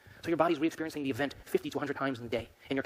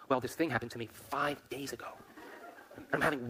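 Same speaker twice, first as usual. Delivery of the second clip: slightly softer put-on explanation; aggrieved explaining; 4 dB quieter than usual. Moody person explains to friend, aggrieved explaining. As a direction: play someone nursing an old upset.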